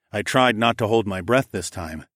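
ordinary delivery

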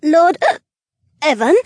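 tipsy woman hiccuping; drunk hiccup. Acted. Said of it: "she announced with another hiccup".

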